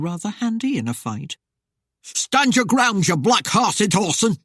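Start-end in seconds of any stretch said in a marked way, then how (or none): 2.0-4.4 roar. A narrator's reading. Husband with axe challenges the rider, roaring. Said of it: "he roared".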